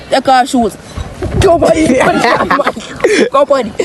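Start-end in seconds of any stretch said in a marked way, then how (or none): 1.4-3.7 laughing. Real, not acted. Laughing while telling.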